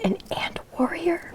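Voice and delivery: soft questioning whisper. Acted. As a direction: whisper the guess.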